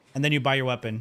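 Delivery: normal talking voice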